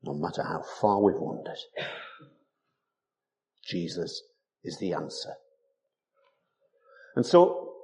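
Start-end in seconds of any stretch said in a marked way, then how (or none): 0.0-5.4 hushed, breathy echo of the line; whispered repeat. Shown as a demonstration. Preacher echoing the congregation's wandering, whispered. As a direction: let it fall to a whisper. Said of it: "repeated softly".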